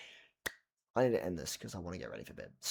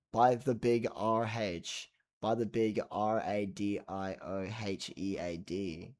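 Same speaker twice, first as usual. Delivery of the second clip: drawn-out letter by letter; slow spelled out; slower than usual. Shown as a demonstration. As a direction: spell it slowly, grandly. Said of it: "spelling it out".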